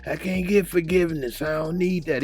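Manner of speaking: a hint of a proud young man refusing help; voiced Ronnie pleading. Ronnie refusing Lil Rel's help.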